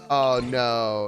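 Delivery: ordinary talk, nothing marked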